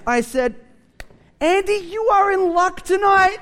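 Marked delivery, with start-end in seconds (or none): 1.4-3.4 high-pitched, eager retold comeback; triumphant announcement. Pastor answering boastful salesman's challenge, gleefully triumphant. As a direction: pitch it way up like announcing good luck.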